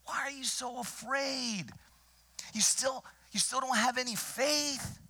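Jesus asking his frightened disciples, incredulous; exasperated Jesus. Acted. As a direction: plead with them in disbelief. Preacher voices Jesus scolding disciples.

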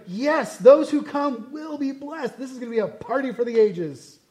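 high, loud mock enthusiasm, words stretched out; excited proclamation. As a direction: proclaim the party of the ages. Acts out eager promise of party.

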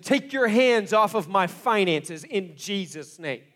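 loud, slow, forceful declaration; authoritative command. Retold or imitated. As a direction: order him out in Jesus' name. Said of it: "He is mad at something".

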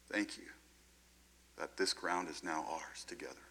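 soft, near-whispered thanks; hushed prayer. Acted. Believer thanking Jesus in a love letter, hushed. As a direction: drop to a quiet, intimate prayer.

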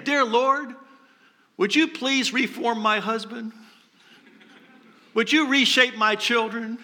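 acted-out earnest request to God; mock pleading prayer. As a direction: plead as if praying for someone else to change. Acting out people's prayers for others.

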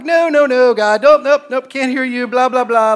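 high, loud mock protest; loud frantic refusal. Telling God he can't hear the number, hammed-up loud protest.

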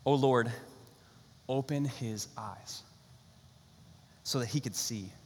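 soft, very slow hushed prayer; quiet prayer. Retold or imitated. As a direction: pray it gently under your breath. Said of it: "This is what he prayed".

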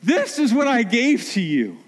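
high, eager voice offering a gift; giver proudly presenting. Retold or imitated. Someone presenting a gift to a parent, high and proud. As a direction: hand it over proudly, pitched well up. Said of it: "And they go".

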